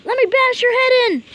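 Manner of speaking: high, loud eager yell; excited yell. Real, not acted. Gamer yelling at the screen, all excited. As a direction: yell it out, thrilled.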